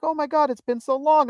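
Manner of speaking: high-pitched mimicked gush; gushing greeting. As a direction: imitate a delighted long-lost friend. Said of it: "She's like".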